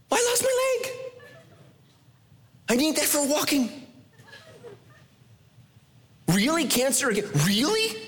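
high, whiny complaining; high indignant whine; mock complainer. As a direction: act out the sufferer griping at God. Mocking complainer to God.

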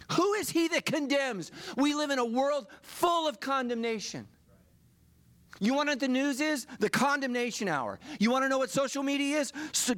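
full-force preaching. Acted. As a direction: hammer the crowd with each question.

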